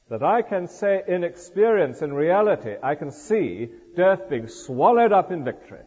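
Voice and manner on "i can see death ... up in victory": ordinary talk, nothing marked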